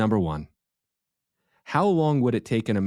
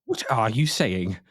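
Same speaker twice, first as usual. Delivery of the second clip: slow, put-on British query; pretentious British accent; slower than usual. Host challenging his co-host's complaint, in a pretentious British accent. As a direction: draw it out in a mock British accent. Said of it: "pretentious British accent".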